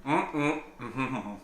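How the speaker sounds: humming through a mouthful of food; mouth full. Real, not acted. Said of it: "Sorry for speaking with a mouthful".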